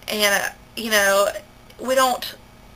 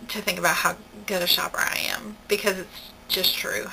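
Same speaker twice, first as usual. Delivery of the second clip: unvoiced smug whisper; 7 dB quieter than usual. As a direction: murmur the brag under your breath. Whispered bragging to herself.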